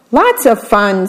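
plain reading voice

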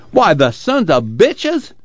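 high, slow, furious outburst; outraged. Acted. Father cursing the bosses who cut pay, outraged. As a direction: spit out the curse in disbelief.

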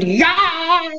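a character's sudden scream, held back in volume. A narrator's reading. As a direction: let out a high yelp of a scream. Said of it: "Leslie screamed".

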